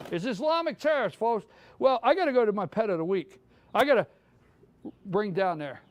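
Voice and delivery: ordinary delivery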